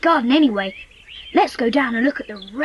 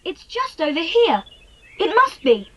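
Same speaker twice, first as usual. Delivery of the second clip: ordinary talk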